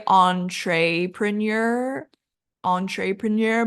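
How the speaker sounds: entrepreneur as on-tray-prin-YUR, with a clear tray; common mispronunciation. Shown as a demonstration. Teacher showing what many people say, entrepreneur with tray. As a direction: put TRAY and a Y glide into entrepreneur. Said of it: "So many people say".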